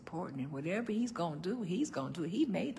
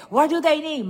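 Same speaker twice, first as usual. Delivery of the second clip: even louder and higher, pushier repeat; louder insisting; 10 semitones higher, 16 dB louder than usual. Retold or imitated. Grandmother repeating the gift question more forcefully, louder. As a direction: push harder, nearly shouting. Grandma pressing daughter for gifts.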